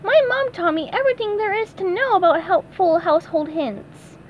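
breezy high cartoon reassurance; easy high cartoon voice. SpongeBob reassures Sam in a breezy high cartoon voice.

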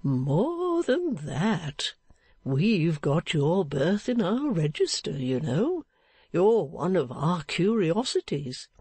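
put-on slow, drawn-out old man's voice; good-natured, leisurely old fellow. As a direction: savour the words slowly as a chatty sexton.

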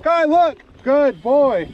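high, loud call across the field; calling from far away. Real, not acted. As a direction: call out to the running dog. Calling dog on trail.

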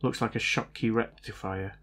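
ordinary talk, nothing marked

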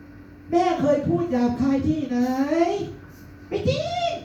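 high-pitched denial. Retold or imitated. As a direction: deny it in a sky-high squeak. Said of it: "แฮชแท็กเสียงสูง".

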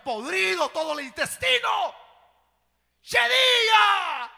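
loud, high cry of disgust; disgusted shout. Real, not acted. Preacher shouting the stench to the church. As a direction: bellow how bad it stank.

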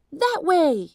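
loud, high directing shout; urgent cry. A narrator's reading. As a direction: call out the way to safety. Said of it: "he cried".

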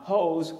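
"Jose" as one syllable, like "hose"; wrong unaccented pronunciation. Shown as a demonstration. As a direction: drop the final vowel of "Jose". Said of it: "and not Jose or".

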